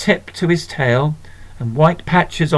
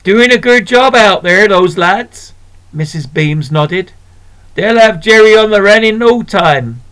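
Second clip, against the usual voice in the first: put-on louder, higher hearty older woman; rally behind the lads; hearty shopkeeper; 4 semitones higher, 5 dB louder than usual. Shopkeeper praising the soldiers, louder and hearty. Missus Beams cheering soldiers.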